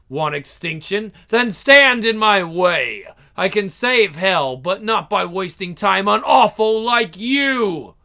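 usual speaking voice

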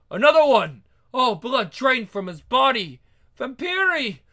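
loud, frightened cry of discovery; alarmed shout. Acted. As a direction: shout the news in terror. Villagers find a bloodless victim.

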